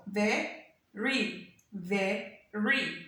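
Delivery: "ve, ri" with a clear gap between syllables; split into syllables. Shown as a demonstration. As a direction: say very as two separate beats, veh and ree. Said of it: "sound that we kind of say individually".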